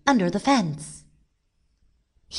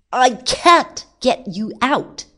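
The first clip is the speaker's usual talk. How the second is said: loud, higher complaining voice; exasperated cry; 7 semitones higher, 6 dB louder than usual. A narrator's reading. Man pulling at stuck rabbit, loud and exasperated. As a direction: call it out in frustration.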